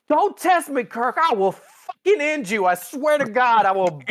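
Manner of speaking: high, loud overblown rage; hammed-up hothead threatening; mock furious threat. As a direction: Spock losing it at Kirk, shrill mock fury. Spock threatens Kirk over coaster.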